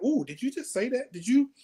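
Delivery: a retold gasp of disbelief at what he said; shocked disbelief. Backstage with his wife, reacting to the comic in shock. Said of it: "I'm like".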